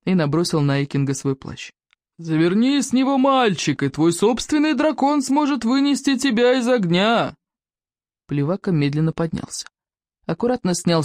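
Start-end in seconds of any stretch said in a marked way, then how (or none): 2.2-7.4 calling out. A narrator's reading. Warrior calling to the boy.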